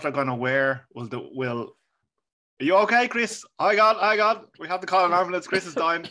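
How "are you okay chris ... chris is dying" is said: high, loud fake panic; mock alarm. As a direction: call out in joking alarm. Mock panic as friend chokes.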